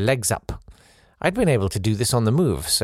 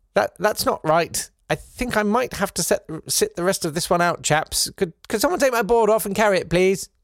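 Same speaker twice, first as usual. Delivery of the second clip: much higher, prim, understated English politeness; stiff upper lip; 8 semitones higher than usual. Retold or imitated. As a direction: play it as if nothing's wrong, voice lifted high.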